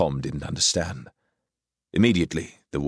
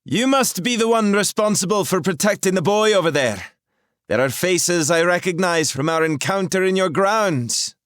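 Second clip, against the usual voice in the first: high, loud commanding woman's voice; a sorceress demanding answers, loud and clear; 6 dB louder than usual. A narrator's reading. Rahal challenges the intruders, high and commanding.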